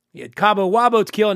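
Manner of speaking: ordinary talk, nothing marked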